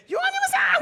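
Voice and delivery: put-on high, loud teasing greeting to a baby; playful call to son. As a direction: sing out a silly greeting at the door. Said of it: "막 이래요". (높고 크게 장난스러운 인사)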